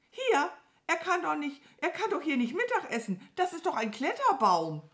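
high, loud crow protest; outraged shrieking. Acted. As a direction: cry out in indignant disbelief. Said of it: "Du schreist ja". (empört und hoch schreien)

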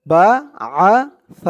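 بعث with a slow, soft ث, tongue tip at the teeth; soft tha. Shown as a demonstration. Teacher showing the soft tha sound. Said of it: "উচ্চারণটা একদম নরম পাতলা হবে".

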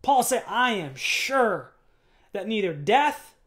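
loud, high, rising sermon climax; full-force preaching. Acted. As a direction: preach it with fire to the church.

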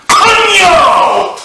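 high, loud sudden yell; screeching involuntary curse; shouted swear. Pretend. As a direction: blurt it out uncontrollably. Mock tic outbursts of swearing.

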